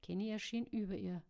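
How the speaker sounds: ordinary delivery, nothing marked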